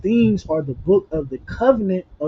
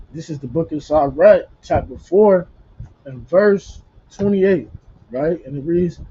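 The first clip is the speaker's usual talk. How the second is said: ordinary delivery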